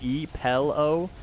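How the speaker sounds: pillow drawn out slowly as "PELL-oh"; slow pillow with an "eh" for the short i; mispronounced pillow. Shown as a demonstration. Streamer demonstrating the teased word, slow "pellow" for pillow. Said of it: "made fun of for saying".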